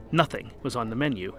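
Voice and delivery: ordinary delivery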